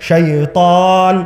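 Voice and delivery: শাইতান slowly stretched on the long final "aa"; drawn-out recited pronunciation. Shown as a demonstration. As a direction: recite শাইতান with a sustained second syllable. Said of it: "যখনই এই অক্ষরটা উচ্চারণ করবেন".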